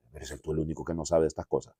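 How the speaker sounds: low, muttered irritation from a disciple; hushed, a touch annoyed at the stranger; annoyed quote. Retold or imitated. Disciple to the stranger, quietly irritated.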